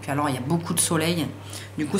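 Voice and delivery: ordinary talk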